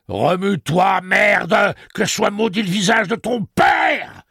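high, loud, slow furious roar; roaring command. Acted. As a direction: roar the curse at him. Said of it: "rugit Roland".

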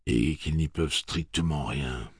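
usual speaking voice

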